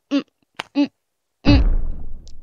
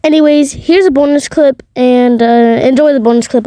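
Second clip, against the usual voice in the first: outro announcer; 9 dB louder than usual. Acted. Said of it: "outro announcement".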